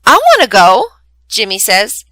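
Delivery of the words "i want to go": bright, louder eager plea; excited child begging to come along. Acted. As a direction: jump in wanting to go too. Eager child hears of trip.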